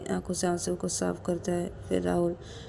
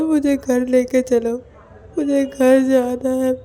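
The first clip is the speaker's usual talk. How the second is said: loud, slow tearful begging to go home; drawn-out weeping pleas, louder and higher; sobbing plea; 6 semitones higher, 7 dB louder, slower than usual. Acted. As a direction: cry and beg, voice breaking. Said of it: "बहुत ज़ोर ज़ोर से रोने लगता है".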